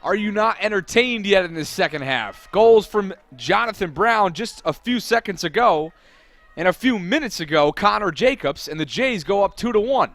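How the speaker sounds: loud, high, thrilled commentary; excited goal recap. Real, not acted. Commentator recapping the Jays going two to one up, excitedly.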